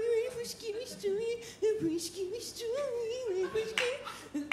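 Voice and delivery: high teasing sing-song; sing-song tease. Acted. Teasing the audience before the story, high sing-song. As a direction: lure the crowd in, playfully. Comic opening with crowd.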